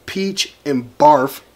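ordinary talk, nothing marked

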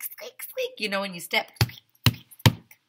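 whispered, airy squeak noises; squeak sounds. Acted. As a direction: imitate squeaky wet shoes. Imitating wet shoe squeaks.

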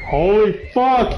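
loud, drawn-out shout; startled shout. Real, not acted.

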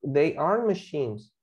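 slow, deliberate model sentence; slow model answer; slow dictation. Shown as a demonstration. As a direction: spell it out slowly for learners. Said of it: "Tenemos que decir que son".

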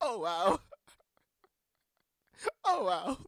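high, loud echo through helpless laughter; laughing mimicry. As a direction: mimic it loudly, nearly crying with laughter. Mimicking the clip while laughing.

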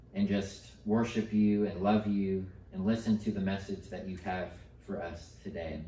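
soft, earnest prayer voice. Real, not acted. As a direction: pray quietly and sincerely. Leads a prayer.

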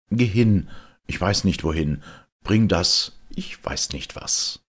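plain reading voice

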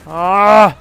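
loud, high "ah" cry; frustrated yell. Real, not acted. Gamer hit in the game, loud frustrated yell. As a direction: yell out as you get hit.

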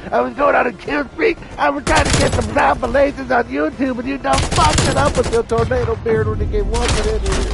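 high, whiny mock rant; mad opponent. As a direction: mimic a sore loser complaining in a high voice. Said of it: "that dude fucking got big mad".

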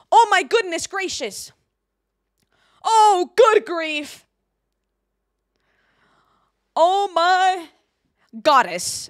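high, loud mock astonishment; exaggerated exclamation. Shown as a demonstration. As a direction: overplay the shock, stretching it out. Practises clean exclamations.

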